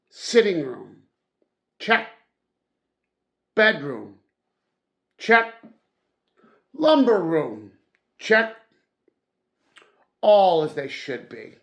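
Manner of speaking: loud, emphatic ticking off each room; barking out a checklist with gusto; brisk checking off. A narrator's reading. Narrator reads Scrooge's inspection as a loud brisk checklist.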